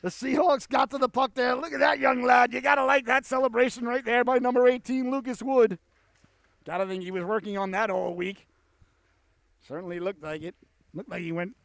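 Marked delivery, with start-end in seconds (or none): 0.0-5.8 high, loud shout that eases off; excited goal call. Real, not acted. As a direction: burst out as they score. Goal scored, commentator erupts.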